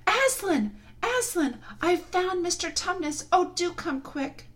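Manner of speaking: put-on high, eager girl's calls; shouting out. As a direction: call out with joy. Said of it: "shouting out".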